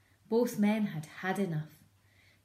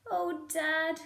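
put-on much higher child voice; small fox child; 10 semitones higher, 4 dB louder than usual. Small fox to his father, high child voice. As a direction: plead like a little cub. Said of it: "said one of the small foxes".

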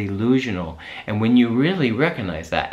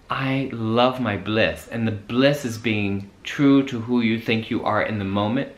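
ordinary delivery

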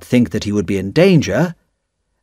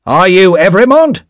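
a touch of roughness, louder and higher; rough question; 7 semitones higher, 6 dB louder than usual. A narrator's reading. Official questioning Darnay at gates, mildly rough. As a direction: demand it roughly. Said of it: "Another official asked roughly".

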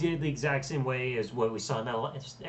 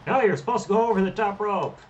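louder, higher and fast protest; exasperated yell; 6 semitones higher, 5 dB louder, faster than usual. Real, not acted. Player yelling at his wrestler's mistake, loud and exasperated. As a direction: shout it at the screen in disbelief.

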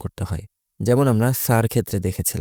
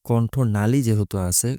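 ordinary talk, nothing marked

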